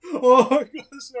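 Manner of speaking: loud, drawn-out delighted gasp; excited gasp. Real, not acted. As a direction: gush with delight. Excited gush over the book.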